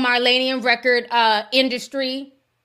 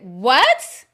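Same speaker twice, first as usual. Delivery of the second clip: shocked what; 3 semitones higher, 6 dB louder than usual. Retold or imitated. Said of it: "I said".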